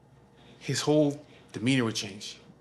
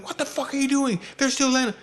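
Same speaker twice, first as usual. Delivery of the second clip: high-pitched irate scolding, mimicked; flipping out; 12 semitones higher, 4 dB louder than usual. Father berating son over landing flies, flipping out. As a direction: imitate furious exasperation at a helper. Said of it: "he's flipping on me".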